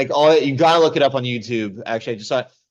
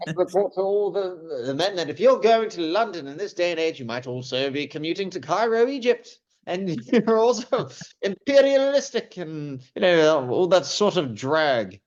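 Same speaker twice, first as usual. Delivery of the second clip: hammed-up posh colonial accent, pitched higher; posh transatlantic; 5 semitones higher than usual. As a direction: play a pompous imperial officer briefing his men. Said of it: "had to speak like this in the military".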